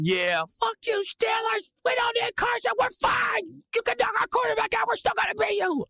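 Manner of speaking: high-pitched screamed trash talk; defiant yelling. Retold or imitated. Fan taunting the Steelers from hotel room, high defiant screaming. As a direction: scream at the rival team like a die-hard fan. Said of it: "So I'm probably yelling".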